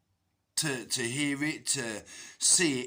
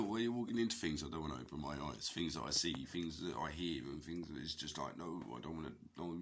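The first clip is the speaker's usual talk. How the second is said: low, hushed and hurried; scared child's thoughts; 6 semitones lower, 9 dB quieter, faster than usual. Retold or imitated. Reliving how his own eyes scared him as a child, hushed and hurried. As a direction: rush the words out softly, as if afraid to look.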